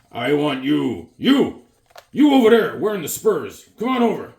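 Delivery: a hint of a swaggering barbarian calling someone over. Barbarian calls to a man in spurs, lightly voiced swagger. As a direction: beckon like a cocky Conan. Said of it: "He's going".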